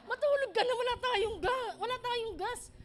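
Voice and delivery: a mother laughing as she tells her son there is no gas; laughing quote. Retold or imitated. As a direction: retell it with a rueful laugh. Mother retells hushing her hungry son.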